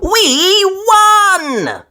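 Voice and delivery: high, squealing joy; excited cheer. A narrator's reading. Peppa cheering about the prize, squealing. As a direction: cry it out with delight.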